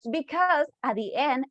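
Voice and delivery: usual speaking voice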